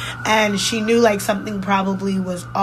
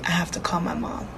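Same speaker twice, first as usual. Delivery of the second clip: hushed, breathy whisper of realization; 3 semitones lower, 7 dB quieter than usual. Retold or imitated. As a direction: drop to a whisper as it dawns. Retelling panic in car.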